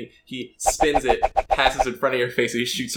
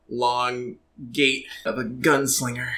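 ordinary talk, nothing marked

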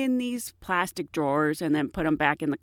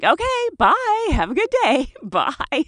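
slow pointed singsong goodbye; 9 semitones higher, 5 dB louder, slower than usual. Retold or imitated. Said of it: "And then I'm like".

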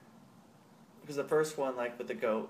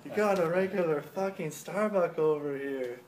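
higher, slow marvel at the throw; impressed skit voice; 6 semitones higher, 4 dB louder than usual. Retold or imitated. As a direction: praise the throw, hammed up. Acted skit quote, impressed.

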